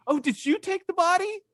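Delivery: high, fast pleading; Mary pleading with the gardener; Mary's voice. Retold or imitated. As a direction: beg the gardener for the body. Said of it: "And she says".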